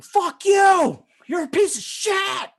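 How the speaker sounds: high, loud mock yell; shouting angry caller. Irate patient cursing the worker who phones, shouting. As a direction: yell abuse like a furious caller. Retold caller yelling abuse at him.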